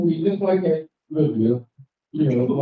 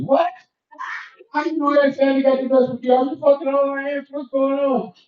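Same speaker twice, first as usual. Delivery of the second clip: shocked; 8 semitones higher than usual. Acted. Shocked reaction to brother.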